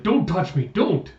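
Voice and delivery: high-pitched acted alarm; alarmed protest. Captive Jonah fighting off the butlers, acted alarm.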